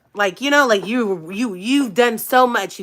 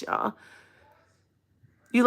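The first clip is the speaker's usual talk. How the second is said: ordinary delivery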